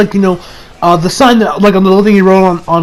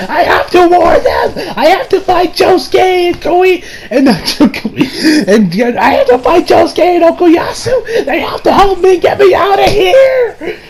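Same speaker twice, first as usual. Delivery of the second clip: high, slow, pleading cry breaking into laughs; Koichi impression; 11 semitones higher, slower than usual. Retelling the episode, a frantic high-pitched Koichi pleading. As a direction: play a frantic teen begging for help. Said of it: "my best Koichi impression".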